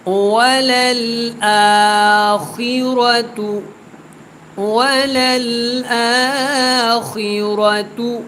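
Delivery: slow, melodic tilawat, each word drawn out; measured, sung-out Quranic recitation. Shown as a demonstration. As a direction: chant the verse slowly and melodically. Teacher recites verse melodically.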